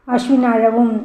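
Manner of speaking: plain reading voice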